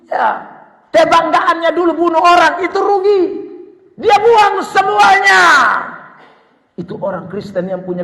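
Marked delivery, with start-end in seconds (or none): 0.9-5.4 high pulpit voice rising to a shout; forceful preaching. Acted. Preacher declaring Paul's pride worthless, forceful preaching.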